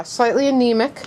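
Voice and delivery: usual speaking voice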